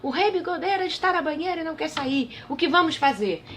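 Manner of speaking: voz aguda de pajem aflito. A narrator's reading. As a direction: avise agudo e aflito. Pajem repete o aviso. (pajem avisando aflito)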